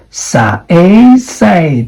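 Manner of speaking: sa-a, say, slow; drawn-out say. Shown as a demonstration. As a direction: say stretched into sa-a, then say. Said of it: "that is the I P A symbol".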